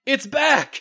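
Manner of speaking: high-pitched excited announcement; excited exclamation. Acted. Host reading out show notes, excited exclamation. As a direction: read it out like it has exclamation points. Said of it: "exclamation point".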